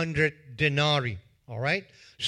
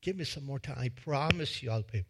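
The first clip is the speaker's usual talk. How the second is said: soft pleading; 3 semitones lower, 7 dB quieter, faster than usual. Acted. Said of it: "He says".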